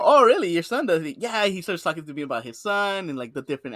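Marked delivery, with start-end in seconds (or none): 0.0-1.0 surprised. Retold or imitated. His own reply retold.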